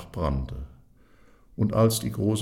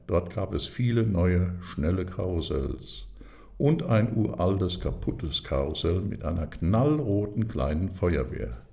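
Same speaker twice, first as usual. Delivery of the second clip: ordinary delivery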